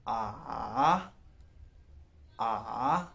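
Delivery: "आ" falls then climbs back up, Mandarin third tone; third tone demo. Shown as a demonstration. Teacher showing students the third tone on a vowel, dipping then rising. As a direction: dip low then rise on "आ". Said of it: "हम इसको कह सकते हैं".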